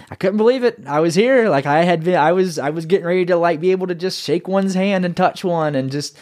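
can barely wait to meet one; excited. Real, not acted. Arriving at the chapel for the first time, high and excited. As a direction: pitch leaps up, tumbling with excitement. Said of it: "It was exciting for me".

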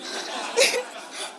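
a quick high airy laugh; high breathy laugh. Real, not acted. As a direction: laugh out at the story. Laughs at Peter's dodge.

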